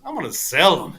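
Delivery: a hint of the scheming caddy; imitated old caddy. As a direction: lightly voice the caddy's reveal. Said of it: "He goes".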